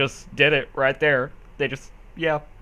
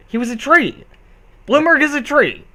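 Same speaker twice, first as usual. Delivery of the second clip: exasperated insistence; 11 semitones higher, 8 dB louder than usual. Real, not acted. Insisting, escalating correction.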